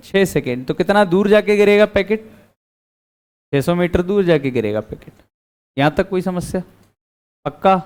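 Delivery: ordinary talk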